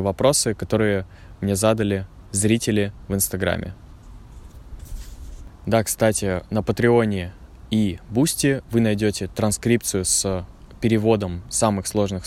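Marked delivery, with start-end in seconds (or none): none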